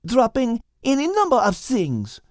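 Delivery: high French cat voice, arguing; French-accented cat reasoning; Liz, French accent. Acted. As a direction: counter him calmly in French accent. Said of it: "Liz argued".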